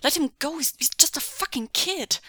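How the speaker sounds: loud, high-pitched outburst; angry protest. Acted. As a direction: burst out in outrage. Acted outburst defending a kid.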